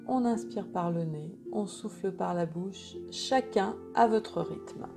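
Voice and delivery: guided meditation. Acted. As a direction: guide them gently into calm.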